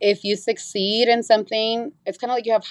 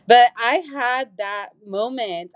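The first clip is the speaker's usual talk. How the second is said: usual speaking voice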